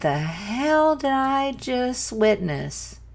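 high, slow, stunned question; stunned disbelief. Real, not acted. Viewer after the song, in stunned disbelief. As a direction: draw it out in real amazement.

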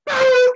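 very high, loud "boo" noise; drawn-out high sound effect; transformation whoosh. Retold or imitated.

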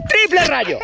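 high, slow announcer shout; excited hype. Real, not acted. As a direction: call out the mode like a hero. Repeats mode call.

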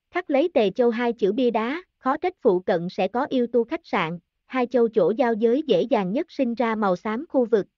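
ordinary delivery, nothing marked

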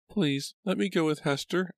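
put-on very high, childlike begging; pleading little girl. A narrator's reading. Abigail begs Papa for time with her friend, pleading. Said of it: "Tears were forming in her eyes".

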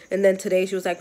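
normal talking voice

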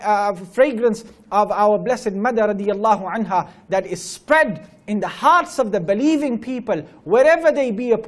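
loud, ringing sermon prayer; fervent closing dua, full voice; preaching at full force. Acted. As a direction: pour it out to the congregation.

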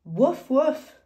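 high, loud double woof; two punchy high woofs; dog bark. Acted. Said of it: "a dog".

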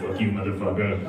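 mock sandwich guy. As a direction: grumble it with cold contempt. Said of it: "He was like".

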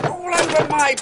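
indignant cry. Acted. Covered in mess.

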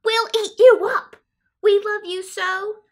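high, loud monster pleading; pleading cry. A narrator's reading. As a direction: cry out to keep him from leaving. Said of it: "the wild things cried".

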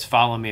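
firm imperative "Follow me!" as an order; commanding. Shown as a demonstration. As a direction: bark "Follow me!" with an exclamation point.

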